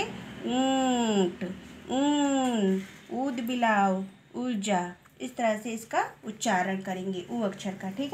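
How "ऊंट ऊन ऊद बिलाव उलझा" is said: ऊंट with the ऊ held very long; long u sound. Shown as a demonstration. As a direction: stretch the first vowel of ऊंट slowly. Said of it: "तो हम इसको कैसे बोलेंगे?".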